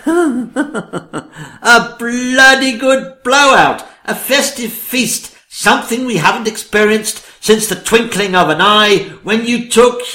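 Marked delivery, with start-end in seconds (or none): 0.1-5.4 laughing through the words, slowed down; gleeful, laughing, high. Acted. Woman delights at feast idea.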